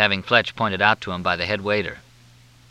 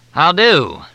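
high, loud, syrupy Southern drawl; Southern officer on the phone, in a thick drawl; 9 semitones higher, 7 dB louder than usual. Acted. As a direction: drawl it out like a courteous Southern major. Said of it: "The drawl was thicker than Mississippi mud".

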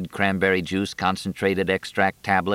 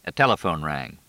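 plain reading voice

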